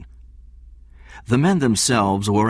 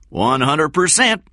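put-on thick Southern accent; 10 semitones higher, 5 dB louder than usual. A narrator's reading. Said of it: "in a thick Southern accent".

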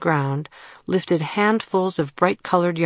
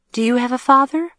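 much higher, quick and bright; curious; 9 semitones higher, 4 dB louder, faster than usual. A narrator's reading. Girl asks the fen boy about his father, curious. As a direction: ask eagerly, wanting to know. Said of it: "she asked the boy curiously".

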